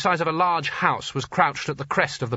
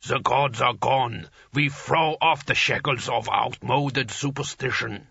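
slow, rumbling alien giant; slower than usual. A narrator's reading. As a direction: rumble it slowly like an ice giant. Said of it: "said the giant".

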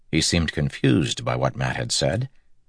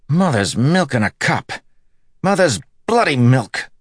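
higher, louder swearing in shock; angry outburst; 11 semitones higher, 5 dB louder than usual. Acted. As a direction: curse out loud at the betrayal. Matt curses after the attack.

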